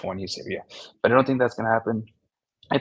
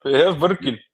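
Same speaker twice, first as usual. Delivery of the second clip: higher-pitched mock Russian; Russian accent; 5 semitones higher, 6 dB louder than usual. Bragging about a handbag, mock Russian accent. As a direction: insist in a put-on Russian accent. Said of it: "Oh, I get to be Russian".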